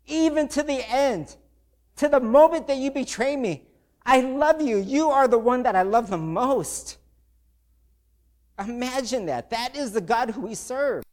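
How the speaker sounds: impassioned emotional preaching; getting emotional. Real, not acted. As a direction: preach it from the heart, near tears. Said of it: "Sorry, I get emotional".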